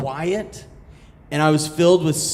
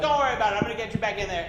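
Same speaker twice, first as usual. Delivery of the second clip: reassuring kayaker; 9 semitones higher, 6 dB quieter, much faster than usual. Retold or imitated. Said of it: "he's like".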